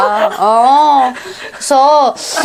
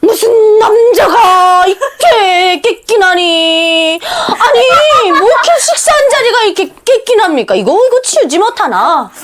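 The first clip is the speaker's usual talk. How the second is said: mother's nagging; 8 semitones higher, slower than usual. Retold or imitated. Mother scolding the father about the messy table, slow high nagging. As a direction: nag him, drawing the words out high. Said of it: "잔소리가 우리 엄마가 한번 시작되면".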